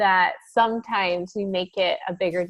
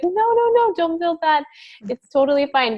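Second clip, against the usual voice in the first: much higher, eager reassurance; reassuring grandma; 9 semitones higher, 3 dB louder than usual. Retold or imitated. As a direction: brush off her worry warmly, pitched way up. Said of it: "And she was like".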